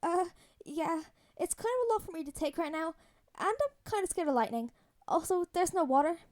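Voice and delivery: put-on young hero sheepishly confessing he's scared; scared, embarrassed boy. A narrator's reading. Voices scared Peter on phone.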